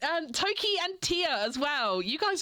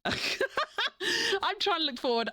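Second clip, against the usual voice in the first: slow, high, laughing; 8 semitones higher, slower than usual. Real, not acted. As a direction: say it through a laugh.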